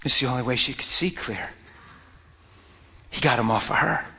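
ordinary delivery

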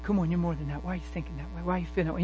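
soft, low, tender coaxing; gentle voice of God. Acted. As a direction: coax softly like a loving father. God speaks gently inside.

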